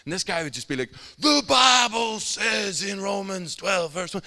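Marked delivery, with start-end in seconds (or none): 1.2-4.2 slow, high, drawn-out mock bellow; bellowing scripture. Street Bible reader proclaims a verse to the crowd, bellowing. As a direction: imitate an ear-ringing Bible reader at full voice. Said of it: "he would just bellow out the scriptures".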